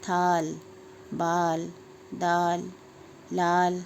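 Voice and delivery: each word from थाल to शान with its आ held long; slow word drill, stretching aa as in थाल, बाल, दाल; long aa demonstration. Shown as a demonstration. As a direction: draw out every आ, as in आग and दाग. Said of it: "इसका उच्चारण भी देखो अलग हो रहा है".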